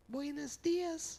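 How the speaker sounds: very high, drawn-out falsetto; slow greeting way up in falsetto; odd squeaky greeting voice. Retold or imitated. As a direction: imitate a friend's involuntary high-pitched greeting. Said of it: "Why do I do that with my voice".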